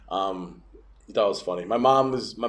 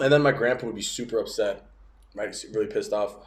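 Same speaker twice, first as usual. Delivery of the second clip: ordinary talk, nothing marked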